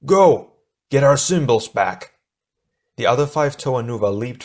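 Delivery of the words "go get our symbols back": loud, high urgent shout; strained command. A narrator's reading. Tahu orders the Toa onward while straining, shouting. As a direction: shout the order through the exertion. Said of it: "his voice twisted with exertion".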